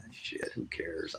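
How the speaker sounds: slow, breathy give-up whisper; whispered "shit, who cares?". Real, not acted. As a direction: let it out under your breath, defeated. Gives up on the search.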